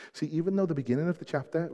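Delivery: ordinary talk, nothing marked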